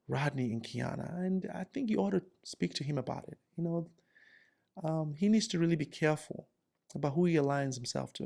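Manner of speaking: hushed, confidential report to the boss; softly voiced office informant; soft-spoken Lindsay. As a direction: drop the volume and tip them off discreetly. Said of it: "Soft-spoken Lindsay".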